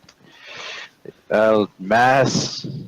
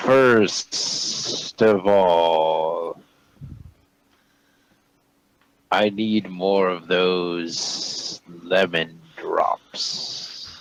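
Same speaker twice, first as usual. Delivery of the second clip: slow deliberate voice; 2 semitones lower, much slower than usual. Acted. Monster speaking slowly.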